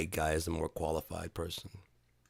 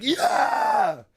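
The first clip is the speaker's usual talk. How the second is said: very high, loud triumphant yeah; joyful cheer; 10 dB louder than usual. Retold or imitated. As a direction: shout back in triumph. Speaker cheers back.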